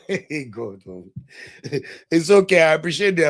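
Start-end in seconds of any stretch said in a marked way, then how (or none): 0.1-0.8 lower, softer, laughing through it. Real, not acted. Laughing repeat of the aside. 0.9-2.1 soft low chuckle; low laugh. Real, not acted. Laughing after joke to God.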